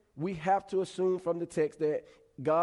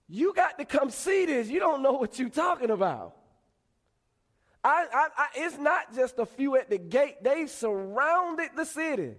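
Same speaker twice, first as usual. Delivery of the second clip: high, loud, stammering insistence; panicked protest; 9 semitones higher, 5 dB louder than usual. Acted. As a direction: argue back as the alarmed servant. Panicked servant argues with Elisha.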